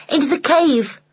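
put-on a child owning up cheekily; small boy Rowley. A narrator's reading. Rowley answering his father in a high child voice.

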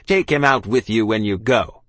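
loud, curt British command; officer snapping. A narrator's reading. Officer to soldiers about the child, snapped. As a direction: snap the order to your men. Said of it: "he snapped".

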